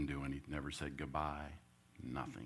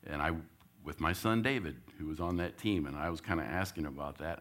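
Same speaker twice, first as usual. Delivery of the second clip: normal talking voice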